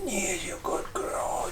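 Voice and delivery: breathless whisper to the dog; soft secret praise for the pup. Real, not acted. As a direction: whisper it close into the dog's ear. Cooing to her dog Huck.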